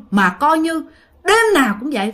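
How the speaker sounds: plain reading voice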